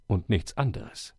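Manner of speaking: usual speaking voice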